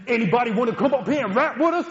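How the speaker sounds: high, loud stage call; rapper call-out. Retold or imitated. Rapper inviting someone on stage, loud high call-out.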